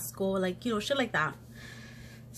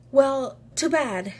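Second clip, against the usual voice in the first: slow, high, louder mock brush-off; dismissive comeback; 6 semitones higher, 5 dB louder, slower than usual. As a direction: draw it out as an imitated "not my problem". Retold rival's dismissive comeback.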